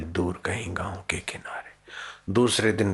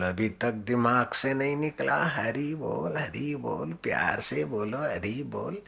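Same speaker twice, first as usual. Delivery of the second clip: acted taunting exchange; 6 semitones higher than usual. बेत खाते हुए संवाद का अभिनय.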